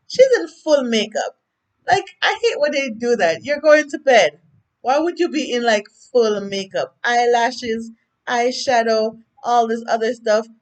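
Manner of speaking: ordinary delivery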